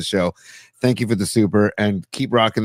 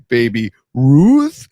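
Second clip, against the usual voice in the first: slow, loud, drawn-out bellow; Sloth from Goonies; 6 dB louder, much slower than usual. Retold or imitated. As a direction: call it out like a lumbering giant. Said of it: "shout out Sloth from Goonies".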